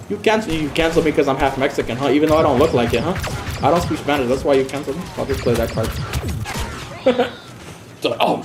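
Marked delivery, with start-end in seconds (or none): none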